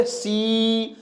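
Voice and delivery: 'सी' held on a long ee; long sustained ee in 'सी'; drawn-out vowel. Shown as a demonstration. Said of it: "sound को खींच रहा हूं".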